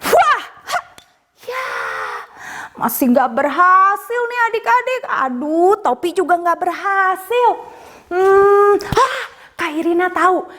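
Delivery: put-on bright, high children's show voice; kids party magician.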